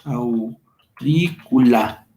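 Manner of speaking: "aurícula" broken into slow, stretched syllables; very slow, low teacher's demonstration; sounded out slowly. Shown as a demonstration. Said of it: "Nos queda".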